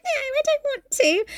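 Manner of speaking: high, reluctant whimper; hammed-up pleading refusal; mock childish whine. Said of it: "And she's like".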